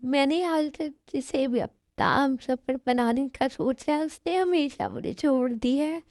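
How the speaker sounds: acted weepy heartbreak; crying. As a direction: pour it out through sobs. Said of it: "अब क्यों रो रही हो".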